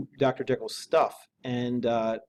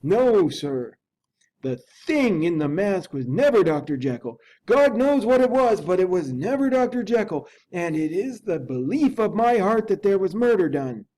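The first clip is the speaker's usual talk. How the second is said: higher, louder butler protesting; the loyal servant insisting hotly; fervent old manservant, higher pitch; indignant butler; 8 semitones higher, 4 dB louder than usual. Acted.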